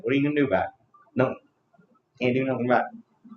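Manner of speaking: usual speaking voice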